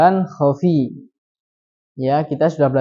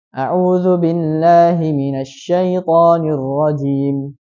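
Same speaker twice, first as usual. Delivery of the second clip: slow tilawah, two-beat ending; Quranic recitation; slower than usual. Shown as a demonstration. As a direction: recite ta'awudz evenly. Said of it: "Itu kan dua harokat". (tilawah ta'awudz dua harakat)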